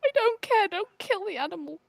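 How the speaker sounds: high-pitched protest for the animal; pleading protest. Real, not acted. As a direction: plead with rising pitch to spare it. Protesting friend's plan to kill animal.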